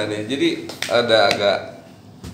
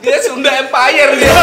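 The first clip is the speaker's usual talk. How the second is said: laughing tease; 13 semitones higher, 9 dB louder, slower than usual. Real, not acted.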